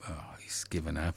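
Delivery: hushed aside off mic; whisper. Real, not acted. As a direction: whisper it to the studio. Aside while caller goes silent.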